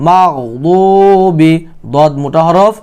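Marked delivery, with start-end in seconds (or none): 0.1-1.5 slow, drawn-out verse recitation; Quran recitation. Shown as a demonstration. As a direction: recite slowly, stretching the long vowels and the final syllable.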